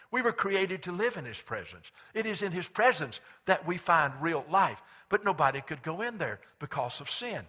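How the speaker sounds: impassioned pulpit delivery; preaching. Real, not acted. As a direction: build toward the climax of the sermon. Sermon rises to climax.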